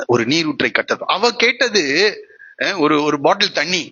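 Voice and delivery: ordinary talk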